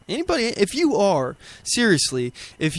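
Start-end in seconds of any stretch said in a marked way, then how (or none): 0.1-1.4 much higher, incredulous anybody. Real, not acted. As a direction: repeat it in disbelief, pitch jumping up. Host repeats question, incredulous.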